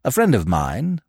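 plain reading voice

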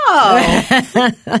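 sudden shout. Pretend. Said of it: "during an interrogation".

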